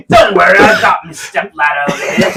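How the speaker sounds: high, loud cackling comeback; laughing retort. Acted. Dwarf shopkeeper laughing off the elf's short joke, loud cackle.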